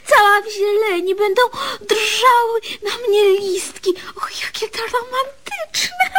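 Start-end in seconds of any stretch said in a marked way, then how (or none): 4.1-6.1 soft, airy romantic whisper; swooning whisper. Acted. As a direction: sigh it out dreamily. Swooning at the birch idea.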